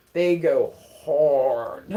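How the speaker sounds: "hard" stretched out very long; drawn-out emphasis. Real, not acted. Generalizing about dumb people at home, drawn-out emphasis. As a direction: drag "hard" right out for comic weight.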